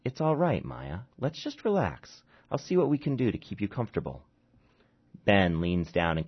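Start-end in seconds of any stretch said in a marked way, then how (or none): 0.1-4.2 soothing doctor. Acted.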